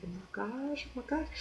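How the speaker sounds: very soft, awed little exclamation; hushed amazement. Retold or imitated. Hearing they are auditioning today, hushed amazement. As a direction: say it under your breath, amazed. Retells reaction to audition news.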